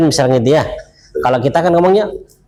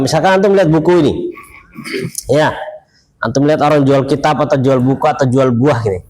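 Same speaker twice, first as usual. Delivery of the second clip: ordinary delivery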